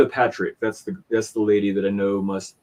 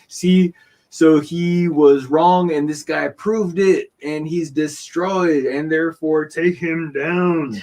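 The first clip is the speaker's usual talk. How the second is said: mocking gloating; 5 semitones higher, 4 dB louder, slower than usual.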